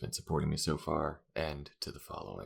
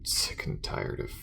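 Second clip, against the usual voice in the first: grudging compliment, then tired venting; halting, worn-out talk to a partner; fed up but easing into banter; weary space crewmate; 2 semitones lower than usual. Acted. Acted character talks with crewmate.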